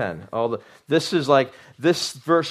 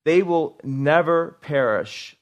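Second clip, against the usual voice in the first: much slower, each word weighted; slow emphatic reading; 3 semitones higher, 3 dB louder, much slower than usual. Shown as a demonstration. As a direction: read it slowly so it sinks in. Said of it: "You see that?".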